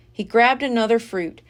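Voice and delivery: ordinary delivery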